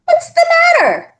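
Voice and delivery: put-on high, fast demanding kid voice. A narrator's reading. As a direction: snap the question out at him, high and quick. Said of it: "Spider demanded".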